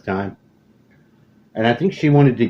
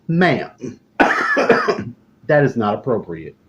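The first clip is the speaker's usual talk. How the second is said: mock-stern scolding, a bit higher; playful indignant lecture; scolding his mother; 4 semitones higher, 3 dB louder than usual. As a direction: wag a finger at mom. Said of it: "was like".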